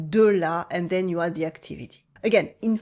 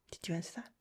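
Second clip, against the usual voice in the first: whispered check-in; 3 semitones lower, 12 dB quieter, faster than usual. Real, not acted.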